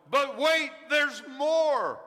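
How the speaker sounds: loud, high Billy Mays-style pitch; infomercial pitchman. Retold or imitated. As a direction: hype it like a TV salesman. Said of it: "the infomercial voice of Billy Mays".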